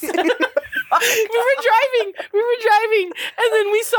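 high-pitched, giggly storytelling; real laughing. Real, not acted. Starting a driving story to friends, laughing high. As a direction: struggle to tell it through laughter.

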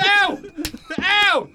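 high, sharp "ow"; hammed-up cries of pain; mock pained yelps. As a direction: yelp as if struck. Pretending to be hit.

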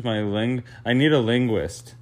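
ordinary delivery

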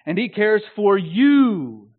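you high, loud and long, then falling; stress on you. Shown as a demonstration. Preacher turning the phrase onto the listener, stress on you. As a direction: build slowly to a big drawn-out you. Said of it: "emphasizing each of the main words".

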